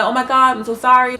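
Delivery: a hammed-up loud sorry; mock apology. Apologizing to angry ex.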